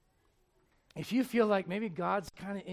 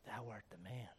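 much softer, lower, grave verdict; quiet accusation; 8 semitones lower, 12 dB quieter than usual. Retold or imitated. Nathan in David's face, quiet grave accusation. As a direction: drop it low and heavy.

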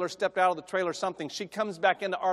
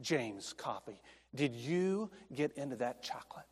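soft, low full-name warning; stern mom; 5 semitones lower, 11 dB quieter than usual. Retold or imitated. Mother quietly confronting her son about the chocolate, stern. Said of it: "She looked at him and said".

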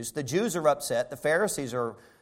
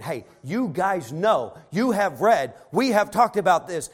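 starts slow, builds higher and louder to a shout; building exasperated plea; 7 semitones higher, 5 dB louder than usual. Retold or imitated. Blind man to Pharisees, building to an exasperated shout. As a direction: plead harder and harder until you shout.

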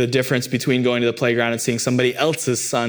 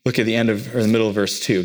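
ordinary delivery

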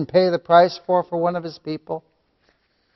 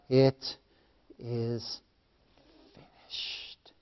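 low whisper with long pauses; hushed preaching; 5 semitones lower, 17 dB quieter, slower than usual. Real, not acted. Preacher dwelling on Christ's final cry, hushed reverent preaching. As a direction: let each word fall into silence.

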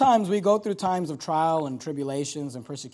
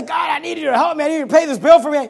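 hurried, raised mock plea; mock begging prayer; 7 semitones higher, 8 dB louder, faster than usual. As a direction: rush through a hammed-up demand to God.

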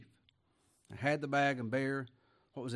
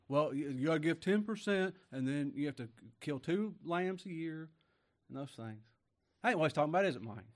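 ordinary talk, nothing marked

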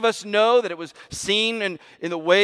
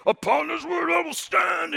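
high, ringing shout of defiance; defiant declaration; 11 semitones higher than usual. Retold or imitated. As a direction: proclaim it like Luther at Worms. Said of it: "him saying".

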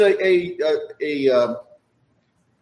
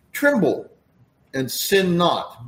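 solemn reading; 4 semitones higher, slower than usual. Shown as a demonstration. Solemn psalm quote.

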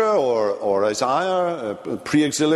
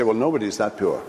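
fast, lower blurt; 5 semitones lower, faster than usual. Pretend. Reader suddenly judging Job, fast blurt. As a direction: blurt it out quickly, dismissively. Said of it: "you sort of suddenly blurt out".

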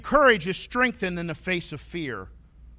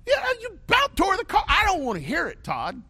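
high, louder mock scolding mother; mom scolding; 5 dB louder than usual. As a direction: snap back at the son like a mom. Mom scolding teen son.

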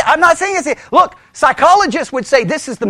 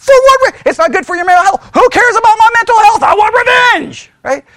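heated retort; 10 semitones higher, 5 dB louder than usual. Pretend. Worldly voice argues for revenge.

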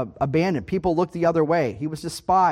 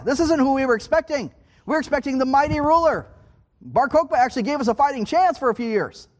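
raised-pitch protest in the people's voice; higher, insistent objection; voicing disappointed objectors; 6 semitones higher than usual. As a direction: argue it indignantly as the let-down crowd.

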